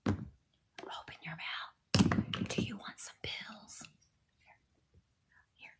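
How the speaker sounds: slow unvoiced whisper to the patient; ASMR doctor whisper. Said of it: "I could be on doing A S M R videos".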